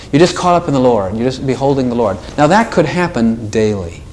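ordinary talk, nothing marked

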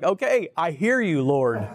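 high start dropping into a laugh; amused surrender. Retold or imitated. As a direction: give in to God, laughing at yourself. Amused surrender to God's message.

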